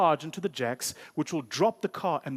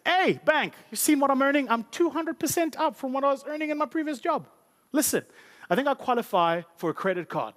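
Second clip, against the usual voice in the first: high, cocky bragging to the bank; hammed-up high brag; boastful customer; 8 semitones higher than usual. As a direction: show off your new salary, pitched up.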